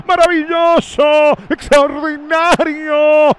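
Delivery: agudo y alargado de euforia; grito de atajada. Real, not acted. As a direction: celebra la atajada a todo pulmón. Atajada del arquero Rochet. (relator eufórico)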